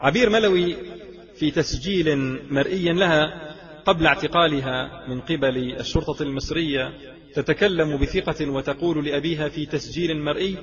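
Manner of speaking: ordinary talk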